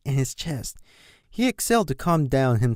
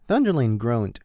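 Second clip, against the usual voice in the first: usual speaking voice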